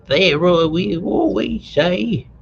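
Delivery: high, slow reproachful mother; mother's remark. A narrator's reading. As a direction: drag it out like a fussing mother. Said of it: "remarked Varvara Alexeyevna".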